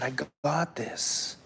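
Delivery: slow, soft, determined retold line; quiet resolve. Dreamer assures his friends before grabbing the bear, quiet resolve.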